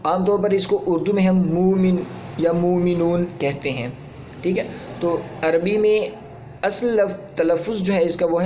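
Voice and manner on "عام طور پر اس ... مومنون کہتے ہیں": normal talking voice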